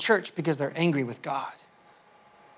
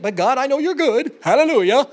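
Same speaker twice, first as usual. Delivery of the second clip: higher, louder churchy Hallelujah talk; fake-happy church voice over hidden hardship; mock pious cheer; 7 semitones higher, 8 dB louder than usual. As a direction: ham up the forced Christianese praise.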